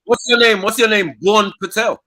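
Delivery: high, loud repeated demand; demanding yell. Real, not acted. Pressing the Dutch guy for his name, a loud high demand. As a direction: bark the question at him again and again.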